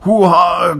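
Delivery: loud, high drawn-out whoa; shocked high-pitched holler; startled cry. A narrator's reading. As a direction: yell as you jump back. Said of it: "cried Greg".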